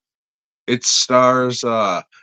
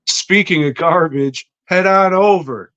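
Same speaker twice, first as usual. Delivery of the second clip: 1 hammed-up shout; 6 semitones higher, 4 dB louder than usual. Ad pitch shouted.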